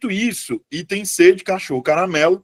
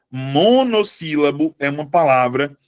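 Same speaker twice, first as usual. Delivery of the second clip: normal talking voice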